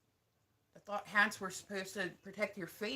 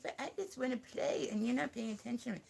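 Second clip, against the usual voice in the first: higher-pitched voicing of a needy puppy; pitched-up pet complaint; puppy's voice; 5 semitones higher than usual. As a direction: speak for the puppy, pleading for attention. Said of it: "He's like".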